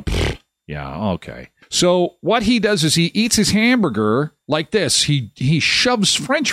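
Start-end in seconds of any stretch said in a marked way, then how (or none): 0.0-1.5 eye-roll scoff. Retold or imitated. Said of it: "You're gonna".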